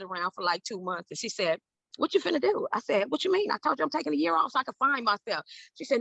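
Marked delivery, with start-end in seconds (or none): 2.0-2.7 high, pointed mom question; lightly voiced mother calling her out; demanding mother. Mother confronting her idle daughter, a hint of stern mom. 3.1-5.4 high, indignant protest; defensive teen. Retold or imitated. Arguing back to her mother, high and indignant.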